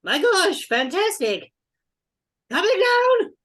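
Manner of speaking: very high, loud character voice; excited voice from above. Acted. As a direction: holler down from a tower window in delight. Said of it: "you hear a voice go".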